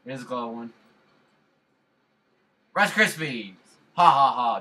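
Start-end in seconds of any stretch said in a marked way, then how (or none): 2.7-3.5 loud, higher yell of Rice Krispies; shouted catchphrase. Pretend. Gamer slipping his running joke in again, loudly. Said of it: "just had to get that in there again". 3.9-4.6 loud laugh. Real, not acted. Laughing after joke.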